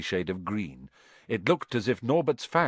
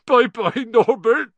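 loud, high wailing farewell; sobbing; 13 semitones higher, 10 dB louder, slower than usual. A narrator's reading. Hagrid says goodbye to his baby dragon, sobbing. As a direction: bawl it out in hammed-up sobs. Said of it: "Hagrid sobbed".

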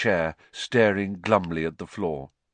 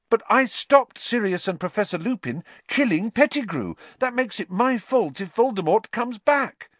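high, loud anguished protest; Harry, aghast; 13 semitones higher, 5 dB louder than usual. A narrator's reading. Harry blames himself to the headmaster, aghast. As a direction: burst out in alarm, pitch way up.

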